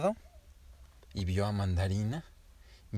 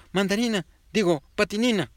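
papá alarmado; 10 semitones higher, 7 dB louder than usual. A narrator's reading. Papá alarmado llama a su hija.

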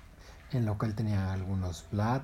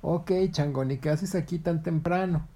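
heron voice; 7 semitones higher, 5 dB louder than usual. Acted. Heron answers.

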